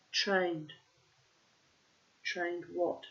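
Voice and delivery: ordinary delivery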